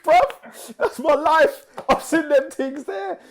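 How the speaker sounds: high, loud comic outcry; loud, high-pitched, insistent objection; exclaiming protest. Real, not acted. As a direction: protest at the top of your voice. Comic protest at "this week" remark.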